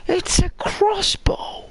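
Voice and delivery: high, breathless squeak of delight; whispered gasp of joy at the find; excited squeal. Real, not acted. Finding a crossbow in the game.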